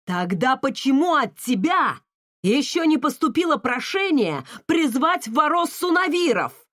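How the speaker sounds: loud, rising angry outburst; exploding in anger. Acted. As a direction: blow up at her. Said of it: "Вновь взорвался отец".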